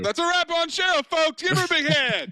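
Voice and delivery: mock announcer send-off. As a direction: wrap it up like an emcee calling for applause.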